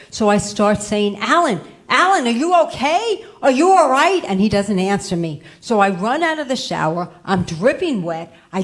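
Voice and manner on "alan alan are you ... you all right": raised, higher call across the house. Retold or imitated. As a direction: call his name from the other room, worried. Calling husband from the shower.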